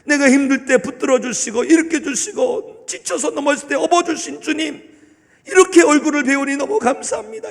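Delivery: heartfelt grateful prayer. Pretend. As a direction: pour out gratitude on first meeting the Lord. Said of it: "이런 역사가 있어야 되는 거예요".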